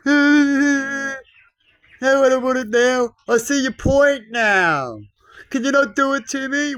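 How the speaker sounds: high, whining plea, slow in the middle; whiny Richie. Retold or imitated. Mocking whiners who want the deal undone, high whining. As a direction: whine like a spoiled rich kid. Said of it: "They whinge and whine like a Richie".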